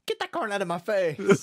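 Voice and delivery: mock indignation. Settler smacking away offered corn, mock indignation. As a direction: play at snapping at an unwanted offering.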